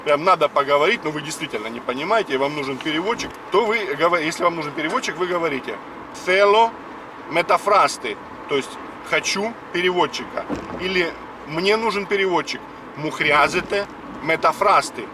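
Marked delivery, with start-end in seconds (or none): none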